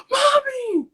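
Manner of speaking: high, loud imitated kid calling out; panicked child's cry. Daughter in the flooding shower calling mom, panicked child's cry. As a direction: cry out like a panicking little girl. Little kids, you know, they panic over stuff.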